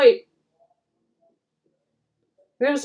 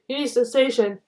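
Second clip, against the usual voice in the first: usual speaking voice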